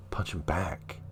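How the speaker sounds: whispered advice to the son; hushed, voiceless aside. Acted.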